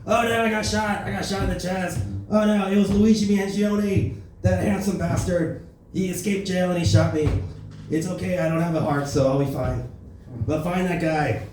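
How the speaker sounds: Trump hammily crying out after being shot; mock-wounded Trump bravado; Trump impression. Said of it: "here's Trump getting shot".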